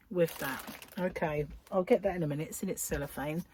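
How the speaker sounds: usual speaking voice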